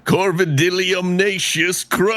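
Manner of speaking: high showy name announcement; character name flourish. Acted. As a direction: proclaim the crow's full name. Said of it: "I kinda wanna hear that one more time".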